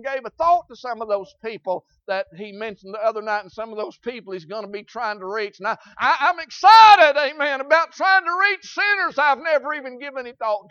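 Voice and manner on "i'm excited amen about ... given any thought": loud, high preaching shout; excited shout. Real, not acted. Preacher shouting his excitement to the church. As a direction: cry it out with fervour.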